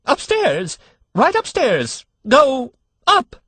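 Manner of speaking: very high, loud panicked squeal; a terrified nurse losing her nerve; hysterical cry. A narrator's reading. Frightened nurse answering the father, hysterical high cry. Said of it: "she cried hysterically".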